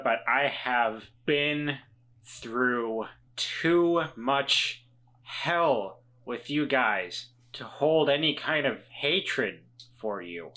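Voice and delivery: ordinary talk, nothing marked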